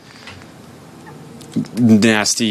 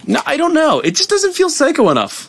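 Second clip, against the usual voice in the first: much higher put-on whiny voice; pouting psycho character. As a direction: whine like a petulant killer. Voicing his psycho character.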